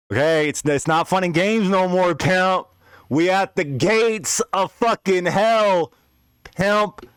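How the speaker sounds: high, urgent alarm, drawn out toward the end; retold panic at hell's gates; freaking out. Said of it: "I'm freaking out".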